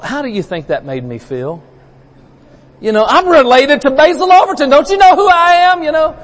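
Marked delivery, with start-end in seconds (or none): 2.8-6.2 high, loud, puffed-up showing off; mock boast. Preacher joking to congregation about famous cousin, puffed-up mock brag. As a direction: brag like you're suddenly famous.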